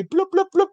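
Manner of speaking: high cartoon bloops; imitated bouncing noise. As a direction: make light, high hopping sounds. Imitates dad hopping down balcony.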